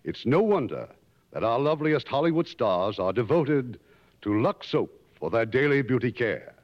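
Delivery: ordinary talk, nothing marked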